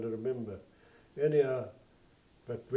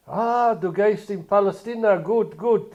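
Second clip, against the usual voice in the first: high, loud, beaming Yiddish praise; delighted barber in Yiddish; 7 semitones higher, 8 dB louder than usual. Retold or imitated. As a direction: cheer him on like a proud old barber. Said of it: "In Yiddish".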